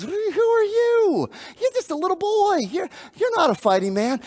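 put-on a king looking down at a boy; doubting king. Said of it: "and King Saul says".

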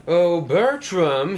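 loud, drawn-out, high call; teasing storybook shout. A narrator's reading. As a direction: sing out the name slowly across a distance. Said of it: "he shouted".

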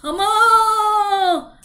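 loud drawn-out calls for mother; scared cow calling. Acted. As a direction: bellow it as a frightened cow. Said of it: "She was like".

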